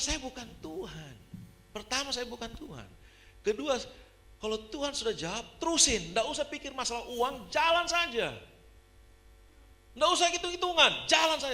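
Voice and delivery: high, insistent reproof building to loud orders; emphatic high-pitched telling-off; retold exasperated scolding. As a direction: set them straight, increasingly forceful. Retold scolding of the calculating woman.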